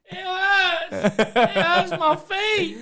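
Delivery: put-on high, slow, whimpering kid; mock crying child. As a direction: whine like a little boy in pain. Boy whining about sandy feet.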